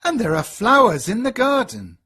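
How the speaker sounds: light up at the flowers; delighted. A narrator's reading. Father sees the garden, mildly delighted. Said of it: "he thinks".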